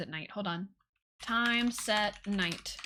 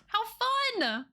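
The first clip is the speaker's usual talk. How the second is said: delighted squeal; 12 semitones higher than usual. Real, not acted. Delighted at the house.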